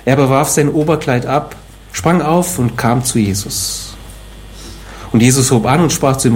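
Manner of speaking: usual speaking voice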